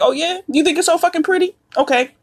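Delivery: sarcastic comeback. Retold or imitated. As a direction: throw it back with sarcasm. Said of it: "I was like".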